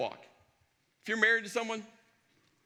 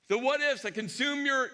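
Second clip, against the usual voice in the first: ordinary delivery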